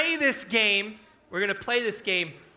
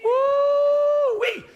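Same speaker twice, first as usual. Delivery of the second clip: high whooping cry; joyful yell at the crowd; excited whoop; 6 dB louder than usual. Real, not acted. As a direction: let out a delighted whoop. Cheering the lively kids.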